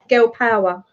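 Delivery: usual speaking voice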